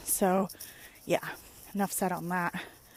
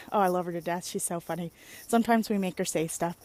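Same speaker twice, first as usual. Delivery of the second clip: ordinary talk, nothing marked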